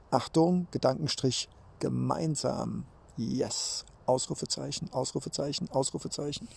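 soft dictation of punctuation; dictating. Pretend. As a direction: read the punctuation out quietly, like dictating. Speaking punctuation marks aloud.

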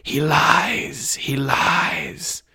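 whispery, drawn-out sinister hiss; high villain voice. Acted. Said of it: "The high voice spoke again".

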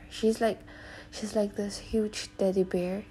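ordinary talk

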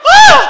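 piercing, high comic shriek; sudden full-volume mock wail; mock panicked scream. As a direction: scream in hammed-up horror. Retells his panicked reaction.

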